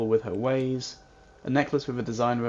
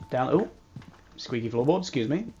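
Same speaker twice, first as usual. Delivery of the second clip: ordinary talk, nothing marked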